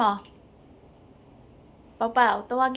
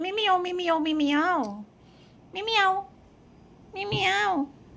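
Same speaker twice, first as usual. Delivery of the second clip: higher meowing mimiyaw; mimicking a meow; 6 semitones higher, slower than usual. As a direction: meow at the cat to coax it. Imitating meow to coax cat.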